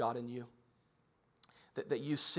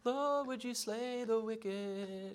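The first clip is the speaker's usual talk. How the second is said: higher, very slow, drawn-out mock prayer; mock sweet prayer; 9 semitones higher, much slower than usual. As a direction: pray it like a sweet hymn line. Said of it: "it's like".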